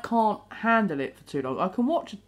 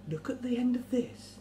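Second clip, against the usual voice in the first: softer, higher hypnotist's coaxing; 4 semitones higher, 7 dB quieter than usual. Acted. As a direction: quietly draw their gaze like a hypnotist. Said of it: "with all the hypnotists".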